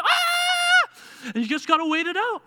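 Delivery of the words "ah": high loud shriek; mock toddler scream. Parent imitating daughter Nola's shrill scream.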